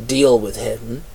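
ordinary delivery, nothing marked

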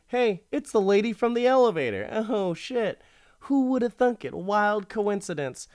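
high, fast, hammed-up shock; mock surprise. Narrator telling listeners the wife is the elevator lady, mock-shocked and high. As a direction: play the big fake gasp of recognition.

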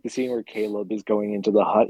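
ordinary talk, nothing marked

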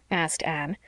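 ordinary delivery

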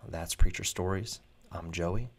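normal talking voice

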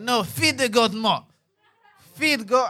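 insistent put-on Haitian parent voice. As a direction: imitate Haitian parents giving an order. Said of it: "I asked my Haitian parents".

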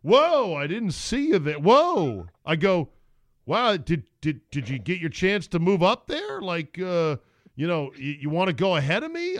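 sarcastic. Retold or imitated. Retelling his sarcastic jab at the line jumper. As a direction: lay the sarcasm on thick. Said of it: "I laid on the sarcasm".

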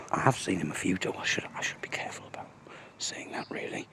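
hushed, voiceless aside, almost inaudible; cautious whisper. Real, not acted. Walking past the farmer's place, whispering about him to listeners. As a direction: whisper it so the neighbour won't hear.

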